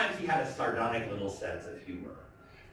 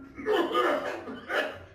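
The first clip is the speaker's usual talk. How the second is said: put-on drunken laugh. Fortunato cackles.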